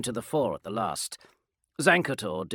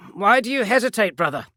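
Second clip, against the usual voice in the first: raised, louder question to a brother; challenging; 10 semitones higher, 11 dB louder than usual. Acted. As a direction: press him to act. Marine challenges hesitant brother.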